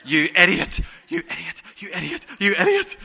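high, loud, exasperated self-scolding; mock self-scolding. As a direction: berate yourself in a mock rant. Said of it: "she was like".

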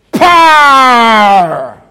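shouted, stretched Irish "power"; imitated Irish accent. Preacher demonstrating to the Irish congregation, imitated accent shouted. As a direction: call out "power" long, in a mock Irish accent. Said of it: "That's like that".

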